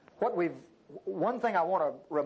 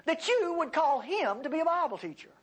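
high-pitched, disbelieving objection; incredulous complaint; 9 semitones higher than usual. Acted. Listener objecting to God about this teacher, incredulous. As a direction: question God's choice in a raised voice. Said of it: "you could say the same thing".

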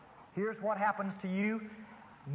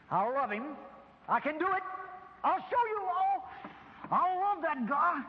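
forced strained resolve; 8 semitones higher than usual. Retold or imitated. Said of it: "I'll start saying".

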